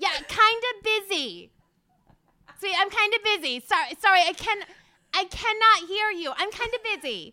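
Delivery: high, mock-apologetic brush-off; dramatic lyric reading. Guest pretending not to hear caller, hammed-up reading. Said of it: "have you read some lyrics of hers".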